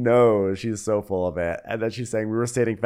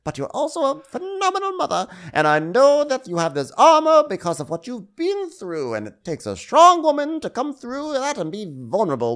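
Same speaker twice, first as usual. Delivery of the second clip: mock housewife speech; 8 semitones higher than usual. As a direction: gush the fake compliments. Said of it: "acting out reunion speech".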